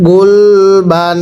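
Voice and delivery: গুলবান stretched very slowly, ending on a dental n; teacher modelling গুলবান slowly for students; slow drawn-out reading. Shown as a demonstration. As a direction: read গুলবান syllable by syllable, slowly, with a clear final n. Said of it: "একসাথে পড়বেন".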